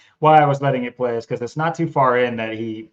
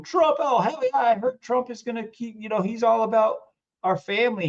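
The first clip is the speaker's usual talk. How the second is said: mock Southern fan; 10 semitones higher than usual. Mocking viewers who stop watching early, high Southern voice. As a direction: gush like a starstruck supporter. Mocking excited Trump supporters.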